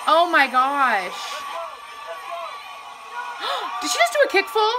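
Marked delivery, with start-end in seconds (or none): 1.5-3.8 sharp soft gasp of awe; stunned gasp; breathy gasp. Real, not acted. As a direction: gasp in disbelief. Reacting to a kick full.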